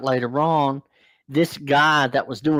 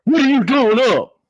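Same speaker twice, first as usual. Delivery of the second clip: barked drill-sergeant yell, much higher and louder; mock yell; 12 semitones higher, 7 dB louder than usual. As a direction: shout like an angry night-shift dorm parent. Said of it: "dorm parent barging in yelling".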